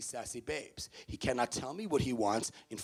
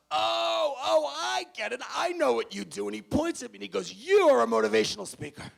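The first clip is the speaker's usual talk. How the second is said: high, loud triumphant realization; guy triumphantly guessing the speaker's job; eureka shout; 10 semitones higher, 5 dB louder than usual. Retold or imitated. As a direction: shout it like you cracked the code. Matt's eureka moment.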